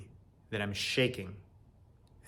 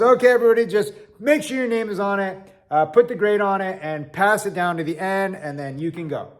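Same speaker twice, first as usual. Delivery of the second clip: high, loud call to the whole room; professor calling out; 7 semitones higher, 5 dB louder than usual. Retold or imitated. Professor telling the class to pass papers down, high and loud. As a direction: project it up to the back rows. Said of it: "he says".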